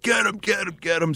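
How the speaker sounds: imitating frantic bystanders; urgent urging. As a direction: egg him on in a high, rapid chant. Guys urging him to rescue.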